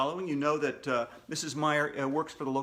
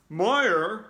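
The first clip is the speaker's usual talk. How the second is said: slightly higher, louder musing; bear voice; 4 semitones higher, 6 dB louder than usual. Acted. Bear pretending not to know Meyer. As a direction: play dumb about the name.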